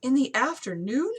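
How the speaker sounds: high, loud startled question; shocked to hear the hour. Acted. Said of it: "Isabel's eyes jolted wide".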